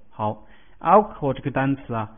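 ordinary talk

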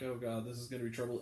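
a quick quiet groan of foreboding; inner dread. Retold or imitated. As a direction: grumble it under your breath. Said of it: "I'm like".

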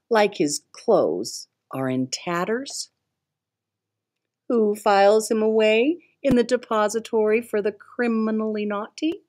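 usual speaking voice

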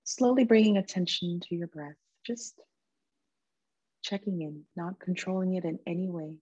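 soft, calm meditation voice with long pauses; guided meditation. Real, not acted. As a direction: gently guide them into stillness, leaving space between phrases.

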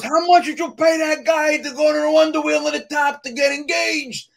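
loud, high-pitched shouted question; yelling across the room. Retold or imitated. Groomsman asking Tony about the Wonder Wheel, yelling across. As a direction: holler it over a whole wedding crowd.

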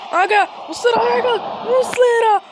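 loud, high yells at the screen; shouting. Real, not acted. Gamer yelling at his goalkeeper Muslera, loud shouts. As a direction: yell at the keeper to get up.

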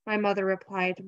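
usual speaking voice

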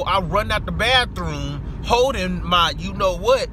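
ordinary talk, nothing marked

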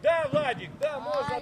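loud call of praise; shouted approval. Real, not acted. Coach approving Владик's move, calling out. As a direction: cheer the move from the sideline.

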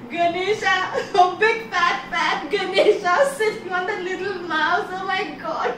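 high, giggling mockery; mock Ganesha through laughter; laughing at the fat god on a mouse; laughing moon. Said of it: "he started laughing".

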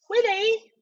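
very high falsetto wife; high-pitched wifely call. Acted. Wife calls through the door.

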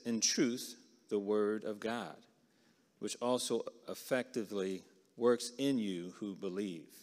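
slow, projected Bible reading; scripture reading. Acted. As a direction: read the verse out firmly and deliberately, easing off toward the end. Reads scripture aloud to the group.

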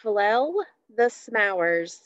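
Flell the smowers said slowly, first sounds swapped; spoonerism. Shown as a demonstration.

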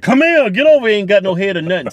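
high, loud ghostly horseman calling out; headless horseman voice. Retold or imitated. To his friend, imitating the headless horseman, loud and high. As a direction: holler like a headless horseman chasing you. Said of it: "the headless horseman was like".

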